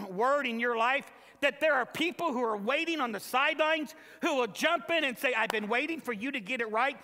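loud, high preacher's call; full-force sermon delivery; fiery preaching. Acted. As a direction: preach it to the back row.

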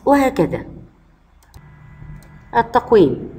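ordinary talk

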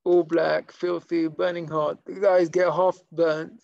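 ordinary talk, nothing marked

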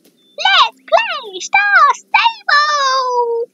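put-on very high, slow child voice; toddler voice. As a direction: squeal it like a three-year-old. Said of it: "like, a three-year-old going".

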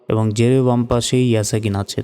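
usual speaking voice

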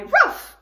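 high, loud little yaps; puppy barks. Shown as a demonstration. Teacher showing kids the puppy sound, high yapping barks. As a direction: bark like a tiny puppy. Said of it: "the puppies can be ruff".

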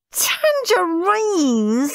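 high, very slow, dragged-out scream; scream of disgust. A narrator's reading. Steve unwraps tangerines and screams in disgust. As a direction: wail it in disgust. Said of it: "screamed Stuck-up Steve".

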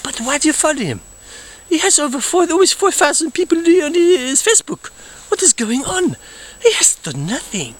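high, baffled outrage; indignant. Acted. The count ranting about his cousin's Facebook fans, high and outraged. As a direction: demand an answer in disbelief.

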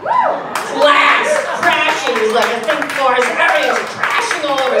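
loud, high crash words; excited crash. Real, not acted. Telling the crowd about the tray crash, loud and high. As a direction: shout out the crash.

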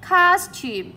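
teacher's model of costume, first syllable stressed. Shown as a demonstration. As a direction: hit COS in costume, let -tume drop. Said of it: "chúng ta đã gạch hết trọng âm một".

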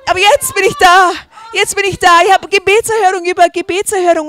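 crying out happy tears; tearful joy. Real, not acted. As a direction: let it break with real emotion. Moved testimony of finding first love.

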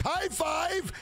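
high, excited call; lightly hammed-up cheer; mock high five. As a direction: cheer it like a victory.

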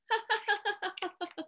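high, rapid ha-ha giggle; soft, bubbling run of laughter; giggling fit. Pretend. Reader shows children letting giggles out, laughing. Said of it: "Let it all out".

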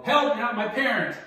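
loud, high shout for help; yelled call for help. Shown as a demonstration. Coach showing child how to yell for help, loud high yell. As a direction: yell it so the whole store hears. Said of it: "She's gonna yell".

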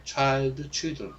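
ordinary delivery

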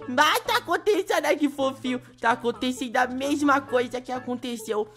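high, loud delighted rush; excited squeal. Real, not acted. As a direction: gush over turning cute. Virou panda, encantado. (empolgado, agudo e alto, encantado por virar panda)

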